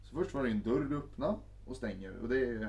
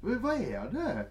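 bewildered guy; 12 semitones higher, 4 dB louder than usual. Retold or imitated. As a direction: plead in confusion. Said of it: "och bara". (hög, förvirrad gnällig fråga)

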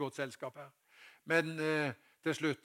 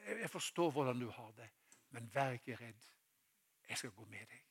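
soft, lower, comforting voice; tender reassurance; 3 semitones lower, 6 dB quieter than usual. Acted. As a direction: gently assure someone who is afraid. Jesus comforting a troubled listener. (mildt og beroligende)

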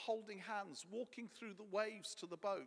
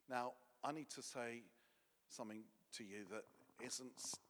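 normal talking voice

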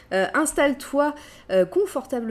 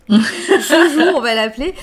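laughing; 3 semitones higher, 9 dB louder than usual. Real, not acted. Laughing over a viewer's name.

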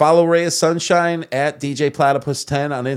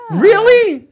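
high, loud disbelieving really; shocked; 10 dB louder than usual. Real, not acted. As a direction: squeal it in disbelief. Shocked by cohost's confession.

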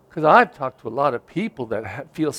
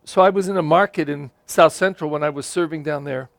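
ordinary talk

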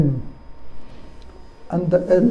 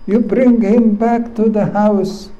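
bitter elder son; 5 semitones higher than usual. Acted. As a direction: hurl the grievance at his father. Elder son complains to father.

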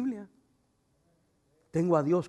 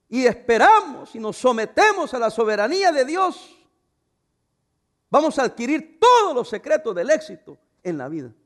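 loud, rising, slowed preaching; full-force sermon climax; fiery preaching; 8 semitones higher, 10 dB louder than usual. Acted. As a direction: build it up like a revival pulpit.

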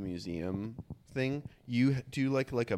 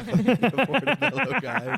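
high, loud, drawn out through laughter; laughing; 11 dB louder, slower than usual. Real, not acted. Cracking up at the Portobello joke, laughing loudly. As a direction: squeal it out while really laughing.

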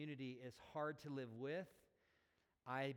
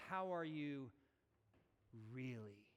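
sincere, probing question. Acted. Pastor asking past the small talk, sincere and probing. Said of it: "What I'm asking is".

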